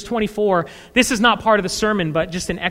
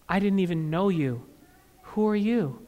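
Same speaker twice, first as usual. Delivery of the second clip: quiet rejection; 4 semitones lower, 9 dB quieter than usual. Acted. Jesus answers softly.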